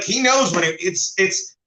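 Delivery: normal talking voice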